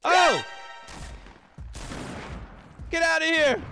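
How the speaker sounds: high, loud play shouting; mock battle yell. Gamer telling an opponent to get out, high mock yelling.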